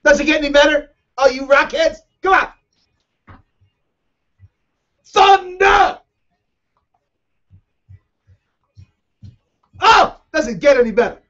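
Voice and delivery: high, loud excited rock fan; hyped shouting. Real, not acted. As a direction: yell along with the song. Host hyped by rock music.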